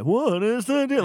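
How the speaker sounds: high, loud Seinfeld bit; over-the-top Seinfeld impression. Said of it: "It's not even a great impression".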